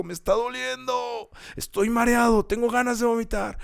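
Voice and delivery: high, strained complaint; hammed-up groaning protest of pain; father in pain. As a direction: imitate a suffering patient, voice pushed way up. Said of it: "como mi papá cuando se sentó y dijo".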